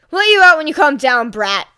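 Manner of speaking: loud, growled retort. A narrator's reading. Mother growling at her tied-up son, loud. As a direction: growl it down at the brat. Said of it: "growled the Bakugo matriarch".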